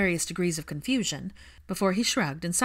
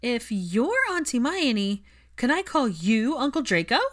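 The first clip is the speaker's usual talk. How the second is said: put-on higher, louder slow child's question; hopeful little boy; 6 semitones higher, 5 dB louder than usual. A narrator's reading. Albus hopefully asking Hermione about Draco, high child voice. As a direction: ask it hopefully, wide-eyed.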